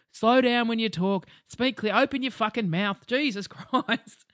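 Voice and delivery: slow, loud, over-articulated nagging; imitating a nagging parent. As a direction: spell it out like an irritated dad. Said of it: "the very things that Dad used to".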